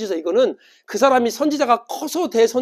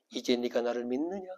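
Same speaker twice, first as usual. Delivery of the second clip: low, hushed, majestic question; voice of Jesus; 8 semitones lower, 10 dB quieter than usual. Acted. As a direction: ask it softly and deep, with quiet authority. Voices Jesus after gentle descent. (낮고 조용한 예수님 목소리)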